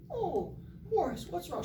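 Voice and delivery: very high, sweetly concerned housewife voice; high falsetto motherly concern; nice little lady. Acted. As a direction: imitate a kindly neighbor mom fussing over a child. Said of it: "nice little Missus Uzito".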